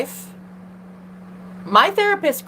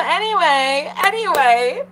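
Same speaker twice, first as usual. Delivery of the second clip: laughing; 7 semitones higher, 3 dB louder than usual. Real, not acted.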